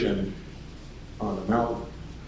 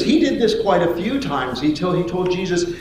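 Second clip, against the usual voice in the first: ordinary talk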